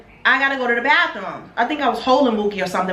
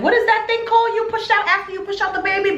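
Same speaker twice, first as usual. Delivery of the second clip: puzzled; 10 semitones higher, faster than usual. Real, not acted. Trying to recall a word.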